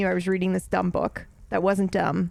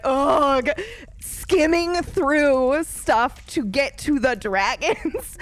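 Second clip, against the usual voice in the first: mock groan; 6 semitones higher, 4 dB louder, much slower than usual. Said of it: "I never thought I would be like".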